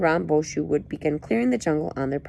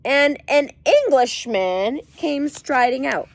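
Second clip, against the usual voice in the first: dramatic story narration; 6 semitones higher, slower than usual. A narrator's reading.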